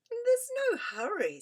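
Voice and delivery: put-on sleepy boy yawning through it; huge yawn. A narrator's reading. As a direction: yawn the words out lazily. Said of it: "with a huge yawn".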